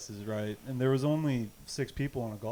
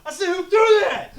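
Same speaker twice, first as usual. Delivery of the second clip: high, loud furious demand; angry coach; 12 dB louder than usual. Retold or imitated. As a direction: bellow down the bus. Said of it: "And he was like".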